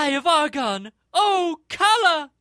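high, loud melodramatic cry; terrified gasp. Acted. Heroine sees the gem, terrified cry. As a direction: cry out in hammed-up terror. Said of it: "gasping in a terrified manner".

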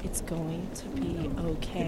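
slow, soft and soothing; slow soothing reassurance, drawn out; reassuring model line. Shown as a demonstration. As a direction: say it gently, like calming a child. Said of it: "look into my eyes and say".

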